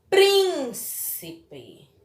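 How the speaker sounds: "Príncipe" with a high, long PRÍN, then falling away; stress on PRÍN. Shown as a demonstration. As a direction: say "Príncipe" as PRIIIN... ci-pe. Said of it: "é a sílaba mais forte". ("Príncipe" alongando bem o PRÍN inicial)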